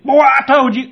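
high, loud scolding shout; grandma's yell. Retold or imitated. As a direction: yell it like an angry granny catching the kid. Grandmother catching the boy.

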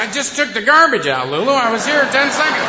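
half-laughing, talk the dog down; loud mock protest. Owner protesting to his overexcited dog, laughing and loud. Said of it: "And I said".

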